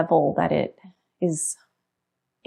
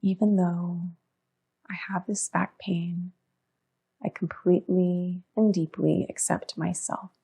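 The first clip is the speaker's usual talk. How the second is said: quiet murmur; 7 dB quieter than usual. Shown as a demonstration. Demonstrates the setup statement softly.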